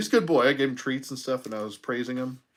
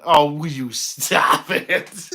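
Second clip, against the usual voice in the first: loud, drawn-out playful scolding; mock exasperation; 4 semitones higher, 8 dB louder, much slower than usual. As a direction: tell off a teasing friend, half laughing. Mock scolding, then laughing at viewer.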